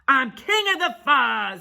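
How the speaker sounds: loud, high, bullying snarl; snarled boast. Acted. Big Bark declaring himself king, snarling. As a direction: snarl it like a tyrant. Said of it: "he snarled".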